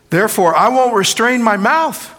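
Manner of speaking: Job's lament. Acted. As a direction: cry it out high and loud. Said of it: "he says".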